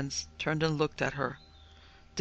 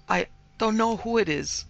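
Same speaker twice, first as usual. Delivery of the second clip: higher, halting frightened reply; frightened gasp; 6 semitones higher than usual. A narrator's reading. Terrified maid to indignant mistress, mild gasp. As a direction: gasp it out helplessly. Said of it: "gasped Cordelia".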